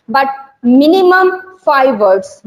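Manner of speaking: minimum drawn out very slowly and weighted; stress on minimum. Shown as a demonstration. Teacher to trainee teachers, insisting on minimum five words, slow and stressed. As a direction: lean hard on "minimum", stretching it out slowly. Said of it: "minimum मैंने कहा है".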